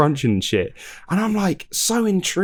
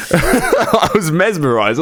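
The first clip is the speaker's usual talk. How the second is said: laughing; 6 semitones higher, much slower than usual. Real, not acted. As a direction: say it through laughter. Laughing while retelling.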